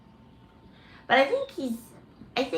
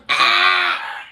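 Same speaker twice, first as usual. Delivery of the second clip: sudden shouted bird cry; abrupt squawking "ah"; imitated bird scream; 4 dB louder than usual. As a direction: mimic a pet bird screaming. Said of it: "she goes".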